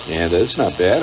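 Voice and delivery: ordinary delivery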